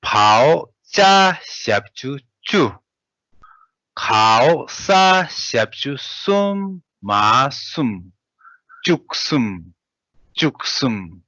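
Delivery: letter by letter spell-out, names called firmly; teacher spelling out for the class; spelling drill. Shown as a demonstration. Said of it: "let's read spell out".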